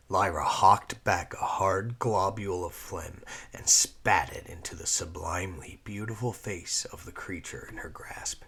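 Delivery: ordinary delivery, nothing marked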